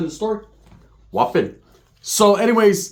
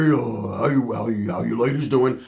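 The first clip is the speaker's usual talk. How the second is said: lower-pitched pickup line; mock smooth greeting; 5 semitones lower than usual. Roach wandering over to greet the ladies, low imitation. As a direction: imitate a buddy sidling up, voice dropped.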